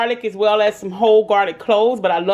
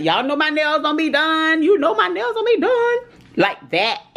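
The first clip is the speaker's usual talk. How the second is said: much higher, teasing greeting in a put-on voice; playful high voice; 9 semitones higher than usual. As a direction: sing out the hello like a silly character. Jokes about her nails.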